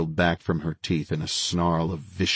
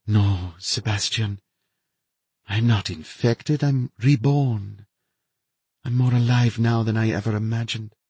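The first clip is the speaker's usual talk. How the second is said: higher, eerie feverish woman; gloating infected scientist, serene; unhinged woman; 5 semitones higher than usual. A narrator's reading. Narrator voices the infected woman.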